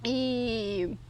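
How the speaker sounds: usual speaking voice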